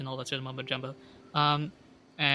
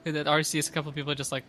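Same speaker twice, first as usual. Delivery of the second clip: ordinary talk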